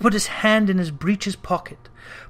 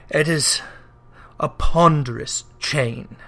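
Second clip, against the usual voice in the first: doomed ghost. Acted. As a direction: speak as one bound by chains. Ghost voice held.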